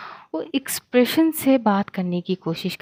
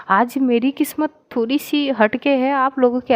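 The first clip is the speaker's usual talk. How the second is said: ordinary delivery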